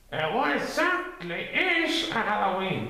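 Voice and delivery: high gopher voice demanding. A narrator's reading. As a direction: press for an answer. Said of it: "demanded Gopher".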